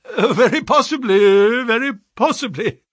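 high, loud, flustered cry; rattled employer feigning cheer; ghastly forced smile. A narrator's reading. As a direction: force agreement through a sickly smile. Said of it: "cried Mister Pinner with a ghastly smile".

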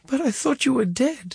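high crying voice; tearful. A narrator's reading. Son sobbing at his mother's bed, high and tearful. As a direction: choke it out through sobs.

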